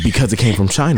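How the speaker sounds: deadpan low blunt retort; flat Trump impression. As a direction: say it bluntly with a straight face. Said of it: "He said, he said".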